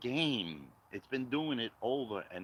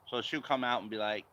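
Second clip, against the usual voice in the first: ordinary delivery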